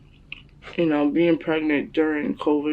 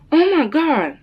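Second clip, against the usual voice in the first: high, louder gasp of disbelief; imitated astonishment; 11 semitones higher, 5 dB louder, slower than usual. Daughter retells mom seeing the sonogram, high-pitched shock. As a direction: mimic mom's stunned discovery.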